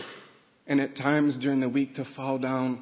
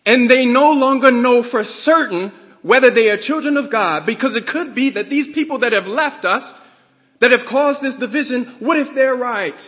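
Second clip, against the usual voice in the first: loud, high, fervent preaching; preaching at full force; 7 semitones higher, 9 dB louder than usual. Real, not acted. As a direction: build it up and hammer each phrase.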